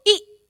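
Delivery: ई said short, high and loud; a short sharp ई. Shown as a demonstration. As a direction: snap out a quick ई. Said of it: "इसको धीरे से उच्चारित करते हैं छोटी ई को".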